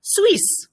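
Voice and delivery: Suisse said as swees with a hissed final s; Suisse, one syllable, ending in s. Shown as a demonstration. Teacher models the word for Swiss. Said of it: "we're gonna change sound a little bit here".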